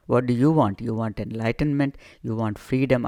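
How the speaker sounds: usual speaking voice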